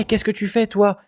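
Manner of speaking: calling out. Shown as a demonstration. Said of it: "On dit".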